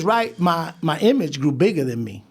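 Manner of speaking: ordinary talk, nothing marked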